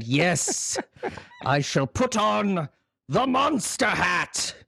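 high, theatrical proclamation; Karloff resolving. Retold or imitated. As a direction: declare it grandly, drawing out the last words. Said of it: "and said".

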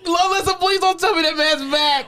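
high-pitched, worked-up protest; exasperated, incredulous outburst; appalled disbelief. Real, not acted. Dreading the patient's return, outraged.

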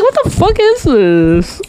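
baffled retelling. Retold or imitated. Baffled by low seating.

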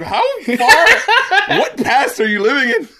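high, loud laughing question; laughing disbelief. Retold or imitated. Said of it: "I was like".

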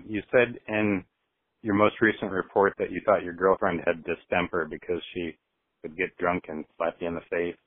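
usual speaking voice

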